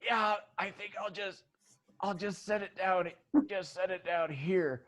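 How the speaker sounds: soft, high-pitched, hesitant demurral; polite refusal. Acted. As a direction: gently back out of finishing the drink. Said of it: "and she goes".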